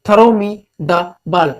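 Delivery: very slow, every word spelled out; धीरे-धीरे उदाहरण पढ़ना; slow example reading. Shown as a demonstration. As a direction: read it out slowly for the class. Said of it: "पहला example लेते हैं".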